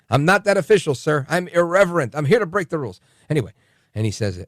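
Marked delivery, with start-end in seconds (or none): 0.1-3.0 louder, higher, defiant; defiant retort. Retold or imitated. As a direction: throw it back at him boldly. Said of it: "I said".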